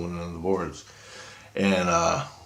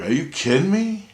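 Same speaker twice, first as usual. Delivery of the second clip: high, incredulous question climbing steeply; retold disbelief; 11 semitones higher, 4 dB louder than usual. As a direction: play up being flabbergasted, voice soaring.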